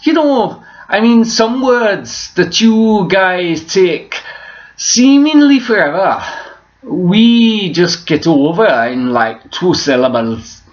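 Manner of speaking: high, loud, stretched-out Welsh accent. Shown as a demonstration. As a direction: exaggerate a sing-song Welsh lilt, leaning on words. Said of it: "if I was to start speaking Welsh".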